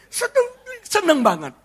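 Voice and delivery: very high, weepy delight; hammed-up happy sobbing; mock tearful. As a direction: squeal it as if crying for joy. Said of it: "Dia nangis".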